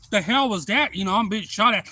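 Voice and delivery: acted startled outburst. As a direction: react as if a gun just went off. Startled by the gunshot.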